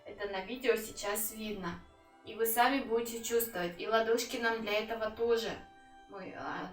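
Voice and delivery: ordinary delivery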